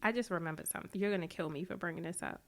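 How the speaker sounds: quiet. Real, not acted. Host recalling an old story about the guest, hushed.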